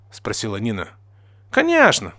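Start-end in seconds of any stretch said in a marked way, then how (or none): 1.5-2.1 very high, loud enthusiastic exclamation; exclaiming. A narrator's reading. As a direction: cry out with eager certainty. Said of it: "воскликнул я".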